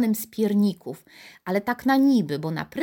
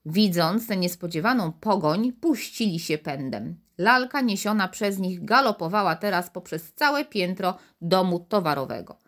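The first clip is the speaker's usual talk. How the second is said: usual speaking voice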